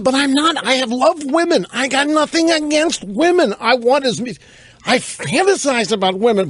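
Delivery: high-pitched mock self-defense; protesting friend. Retells friend insisting he's not sexist.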